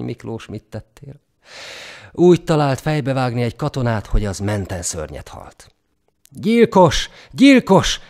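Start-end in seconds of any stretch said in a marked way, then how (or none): 6.3-8.0 nagyon hangos, magas, ismételt kiáltás; üvöltsd a gyilkos után; kiáltozás. A narrator's reading. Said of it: "kiáltották a katonák". (felháborodott, hangos kiáltozás)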